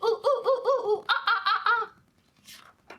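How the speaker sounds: high, playful monkey hoots breaking into laughter; monkey sounds. Acted. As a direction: hoot like a cheeky monkey for the kids. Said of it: "can you do some monkey sounds".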